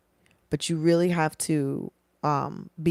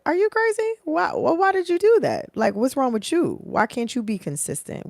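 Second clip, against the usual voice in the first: high, loud disbelieving interrogation; incredulous corporate interviewer; 10 semitones higher, 3 dB louder than usual. Pretend. Shocked corporate interviewer grilling a job hopper, high and loud. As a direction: squeal it like a shocked hiring manager.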